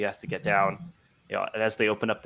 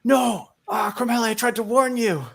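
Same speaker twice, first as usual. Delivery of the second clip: high, strained panicked plea; strangled cry; 14 semitones higher, 5 dB louder than usual. Acted. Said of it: "gives kind of, like, a strangled cry".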